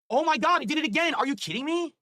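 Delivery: can't believe it happened again; exasperated disbelief. Real, not acted. Streamer sees the leaderboard move again, high exasperated cry.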